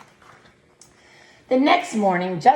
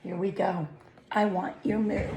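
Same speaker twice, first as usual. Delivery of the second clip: ordinary delivery, nothing marked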